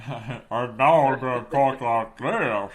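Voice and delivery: loud, slow put-on politician voice; Mitch McConnell. Shown as a demonstration. Host launching an impression for the co-host, slow caricature. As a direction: drag the words out in a distorted voice. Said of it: "an impression of Mitch McConnell".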